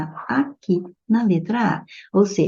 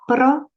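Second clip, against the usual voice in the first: "Própria" com o PRÓ agudo, depois uma pausa; sílaba tônica destacada; 6 semitones higher, 3 dB louder than usual. Shown as a demonstration. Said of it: "paroxítona terminada em ditongo crescente". ("Própria" dividida, com o PRÓ mais alto)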